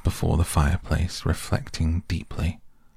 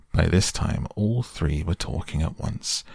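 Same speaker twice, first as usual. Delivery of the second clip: ordinary delivery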